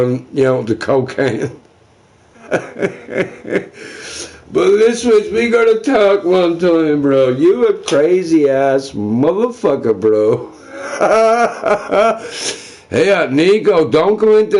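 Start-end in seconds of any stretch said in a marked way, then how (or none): 2.5-4.3 breathy laugh. Real, not acted. Laughs after cocaine joke. 10.7-12.6 a high, open burst of laughter. Real, not acted. Streamer cracking up about his crazy friend, laughing.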